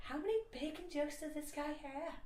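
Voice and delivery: high, small audience-member voice; imitated high-pitched inner heckler; meta-discourse voice. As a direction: comment high and thin, as an aside. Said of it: "meta-discourse voice".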